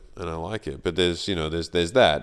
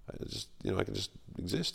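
hushed relief; 2 semitones higher, 9 dB quieter than usual. Retold or imitated. Relieved inner thought, hushed.

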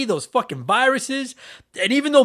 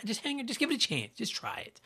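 a soft breathy whisper, voice gone; whispered plea; 2 semitones higher, 14 dB quieter than usual. Real, not acted. Urging new listeners to try the intro, whispered. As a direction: beg gently under the breath.

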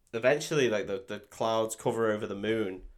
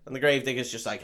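ordinary talk

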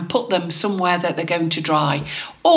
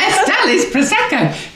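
laughing; 12 semitones higher than usual. Real, not acted. Laughing at the nickname.

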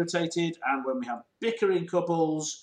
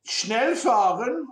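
German words; 5 semitones higher, much slower than usual. Acted. Motto said in German.

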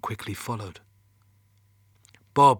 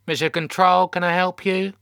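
higher, louder receptionist voice; teenage call-centre girl; 8 semitones higher, 7 dB louder than usual. A narrator's reading. Teenage girl answering Mission Control's phone, high and routine. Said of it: "who sounded in her teens".

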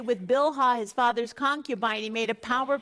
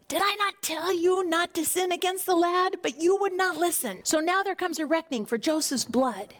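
higher-pitched, accusing reproach; indignant rebuke; 7 semitones higher than usual. Acted. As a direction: scold the brothers as their eldest. Reuben rebukes his guilty brothers.

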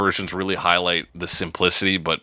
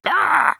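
sharp high squawk, much higher; mock crow screech. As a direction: let out a sudden high bird cry. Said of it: "if that was the Crow Matrix".

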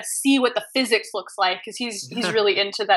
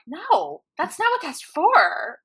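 much higher, the second part slow and whiny; exasperated refusal; 8 semitones higher, slower than usual. Retold or imitated. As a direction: groan it out like a fed-up game master. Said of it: "the D M's like".

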